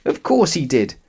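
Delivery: ordinary delivery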